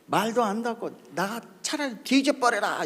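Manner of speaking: a hint of an exasperated grandmother; cursing grandmother. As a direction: curse out a grandchild in mock anger. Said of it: "우리 할매가".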